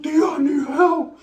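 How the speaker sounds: high, slow mock whimper; whining plea. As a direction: beg tia in a drawn-out high whine. Acted plea to aunt.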